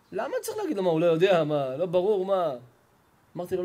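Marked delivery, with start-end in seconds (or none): 0.1-2.6 scoffing disbelief that it needs saying; incredulous father. Retold or imitated.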